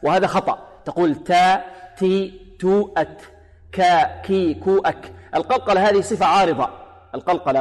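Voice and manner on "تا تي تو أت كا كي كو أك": each of تا، تي، تو with a puff of air; syllable drill, aspirating كا، كي، كو; breathed ta and kaf. Shown as a demonstration. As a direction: release أت and أك with audible breath. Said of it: "أما المتحركة ليس فيها همس".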